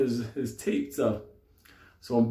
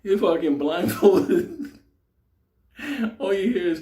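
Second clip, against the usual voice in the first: normal talking voice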